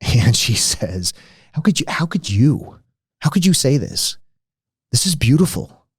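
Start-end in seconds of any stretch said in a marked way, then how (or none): 1.5-5.9 higher-pitched, hurt and indignant retelling; a lightly acted wounded objection; upset protest. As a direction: mimic her offended outcry, voice raised in pitch. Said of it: "she got really upset with me".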